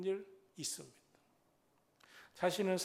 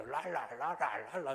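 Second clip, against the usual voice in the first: rapid la-la-la babble; babbled tongues; 4 semitones lower, slower than usual. Retold or imitated. Preacher re-enacting his first schoolboy prayer, la-la babbling. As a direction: imitate childish praying in tongues. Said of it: "이렇게 기도했어요".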